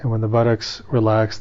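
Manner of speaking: normal talking voice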